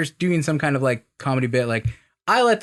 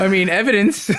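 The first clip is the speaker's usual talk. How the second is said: laughing; 3 semitones higher, 4 dB louder than usual. Real, not acted. Laughing while citing evidence.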